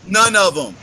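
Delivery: ordinary delivery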